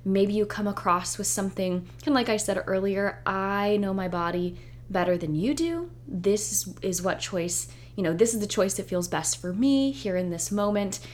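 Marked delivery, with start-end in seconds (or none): none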